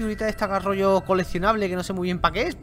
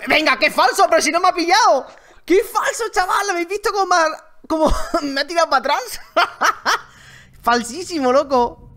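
indignant laughing; 10 semitones higher, 8 dB louder than usual. Real, not acted. Indignant at unfair catch.

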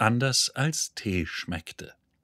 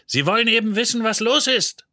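much higher and louder, a full yell; shouting; 12 semitones higher, 8 dB louder, faster than usual. A narrator's reading. Zaphod shouts back at the nervous crew, loud yell. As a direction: shout it over everyone. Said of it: "schrie Zaphod".

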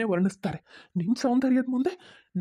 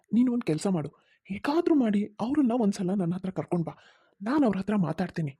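normal talking voice

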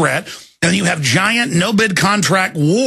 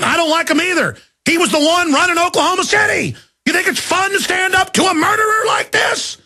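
furious shout; 10 semitones higher than usual. Real, not acted. Host raging at listeners about the attorney general, shouting. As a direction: yell it at the top of your voice, outraged.

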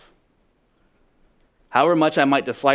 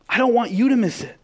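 urgent pulpit cry; impassioned plea; 10 semitones higher than usual. Shown as a demonstration. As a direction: cry it out to the whole room.

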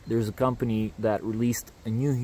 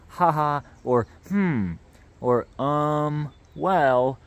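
imitating chatbot fillers; 4 semitones higher, 5 dB louder, much slower than usual. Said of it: "It has these little pauses like".

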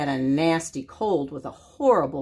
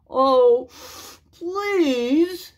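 higher, whiny sniffly please; sniffled plea; 6 semitones higher, 4 dB louder than usual. Acted. Wolf begs the pigs, a sniffly higher please. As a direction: beg through a blocked nose. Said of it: "sniffled Big Bad".

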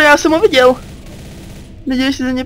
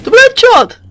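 excited; 10 semitones higher, 5 dB louder than usual. Real, not acted. Excited by a headshot.

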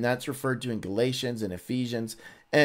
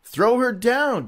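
high, loud, slow order; commanding shout; 11 semitones higher, 6 dB louder, slower than usual. Acted. Jehu ordering the eunuchs to throw Jezebel, high and loud. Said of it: "Jehu said".

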